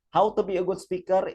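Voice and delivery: announcer voice. Acted. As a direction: announce the title like a host. Announces a slide heading. (nada pembawa acara)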